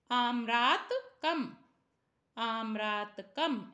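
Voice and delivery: आम्रातकम said slowly, each syllable clear; teacher reading आम्रातकम slowly, twice; model pronunciation. Shown as a demonstration. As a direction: drag out आम्रातकम so pupils can copy it. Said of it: "ऐसे पढ़ेंगे".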